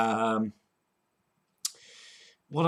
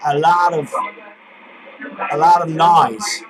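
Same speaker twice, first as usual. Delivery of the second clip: pitched up, louder put-on caller; loud phone voice; 4 semitones higher, 7 dB louder than usual. Prank caller to scammer over a bad line, loud. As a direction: talk loudly over a noisy line.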